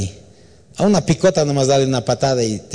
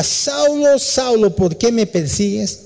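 high, ringing call from heaven; 10 semitones higher, slower than usual. Acted. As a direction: call down from the sky, stretching Saulo. Voice from heaven calls Saulo.

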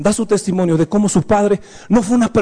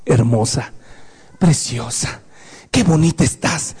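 tender father; 11 semitones lower than usual. Shown as a demonstration. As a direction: speak to your daughter tenderly, voice dropped well down. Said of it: "palabras significativas". (padre tierno, voz baja y suave)

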